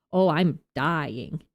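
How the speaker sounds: usual speaking voice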